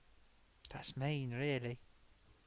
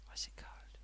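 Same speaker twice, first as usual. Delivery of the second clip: whispered aside; 17 dB quieter than usual. Real, not acted. Whispered aside while checking names.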